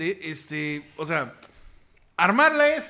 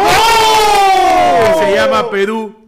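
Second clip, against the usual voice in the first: slow, high, loud shouted punchline; drawn-out shout; 9 semitones higher, 11 dB louder, much slower than usual. Acted. Reading the listener's joke aloud, a slow high shout. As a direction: stretch it out and yell it high.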